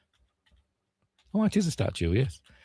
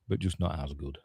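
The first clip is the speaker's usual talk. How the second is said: ordinary talk, nothing marked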